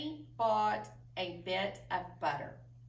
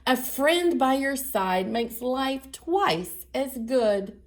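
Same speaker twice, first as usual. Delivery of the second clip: storybook reading; 8 dB louder than usual. Acted. Teacher reading the story's last lines to children, sing-song. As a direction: wrap up the bedtime story with a lilt.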